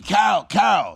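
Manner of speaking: calling Carol loudly across the house; retold scream. Husband yelling for his wife Carol: loud call. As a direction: shout her name to wake her. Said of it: "I'm screaming".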